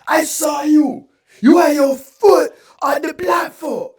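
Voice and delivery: put-on loud, high accusing shout in a Spanish accent; drawn-out Spanish-accented mock yell; thick Spanish accent. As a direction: imitate a Spanish-accented telling-off, slow and loud. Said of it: "in her thick Spanish accent".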